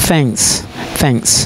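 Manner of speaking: thanks softened and fading; thanks with z ending. Shown as a demonstration. Teacher repeating the drill: thanks, soft z ending. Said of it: "como que fuera una Z".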